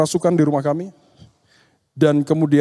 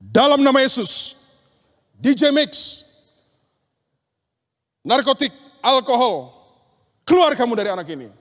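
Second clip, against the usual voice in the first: higher, ringing command in Jesus' name, speeding up at the end; commanding exorcism; 5 semitones higher than usual. Retold or imitated. Preacher orders the demon out of the teenage boy, higher and commanding. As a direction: cast the demon out with authority.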